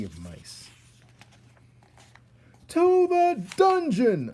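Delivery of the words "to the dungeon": very high, loud, slow mob yell; cried out. Acted. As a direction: scream for punishment from the crowd. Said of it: "a voice cried out".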